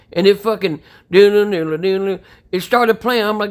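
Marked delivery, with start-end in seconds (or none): 1.1-2.2 quick sung "doo-doo" riff; imitated music riff. Dad mimicking the song the Alexa started, doo-doo riff. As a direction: mouth the tune like an instrument.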